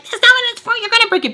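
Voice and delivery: shrill mock of a nagging mom; mocking scold. Mocking the ex's mom who scolds over the iron, high mock scold.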